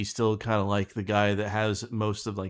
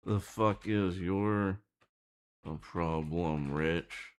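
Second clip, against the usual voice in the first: annoyed complaint; 2 semitones lower, 5 dB quieter, much slower than usual. Shown as a demonstration. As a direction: grumble it out slowly, fed up. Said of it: "and says".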